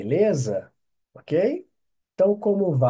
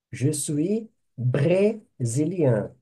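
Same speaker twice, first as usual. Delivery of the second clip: slow dictation; much slower than usual. Shown as a demonstration. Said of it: "teacher repeats phrase slowly".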